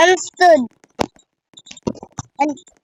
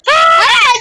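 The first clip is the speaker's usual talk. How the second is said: sharp high shriek; short startled yelp; startled scream; 13 semitones higher, 9 dB louder than usual. Real, not acted. Sudden scare in game.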